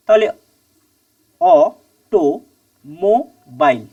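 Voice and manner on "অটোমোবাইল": "অটোমোবাইল" slowly in four separate beats; syllable by syllable. Shown as a demonstration. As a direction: count out অ-টো-মো-বাইল with a pause after each. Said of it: "এই যে চারটে part". ("অটোমোবাইল" চার ভাগে থেমে থেমে, ধীরে)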